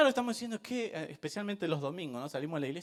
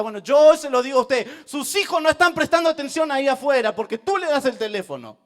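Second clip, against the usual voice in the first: much louder, hammering; preaching at full force; 7 semitones higher, 14 dB louder than usual. Real, not acted. Preacher confronts parents about phones, loud. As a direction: scold the parents firmly.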